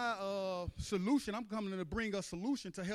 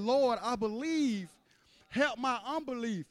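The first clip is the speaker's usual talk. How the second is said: raised, drawn-out plea to the Lord; crying out; 5 semitones higher, 6 dB louder than usual. Retold or imitated. Father cries out to Jesus for help, pleading. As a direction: cry out in prayer, pitched high. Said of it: "He cried out and said".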